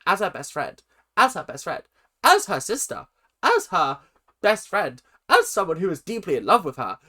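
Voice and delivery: quick, punched mock line readings; mocking repetition. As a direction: parrot the repeated lines, mounting in pitch. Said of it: "they just repeat the same things over and over".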